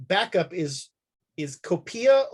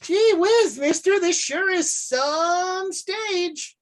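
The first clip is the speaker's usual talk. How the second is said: put-on high, slow gee-whiz stable boy; eager small-town kid, wide-eyed; wholesome 1950s kid; 10 semitones higher, much slower than usual. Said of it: "be like".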